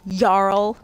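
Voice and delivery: Jarl said as YARL; drawn-out Jarl, Y at the start. Shown as a demonstration. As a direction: open Jarl with a Y sound. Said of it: "J makes that Y sound".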